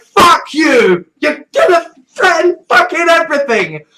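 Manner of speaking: yelled high and loud, cracking with rage; furious mock Roman shout. Romans shouting down the Christians, furious yelling. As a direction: bellow it like an outraged Roman official.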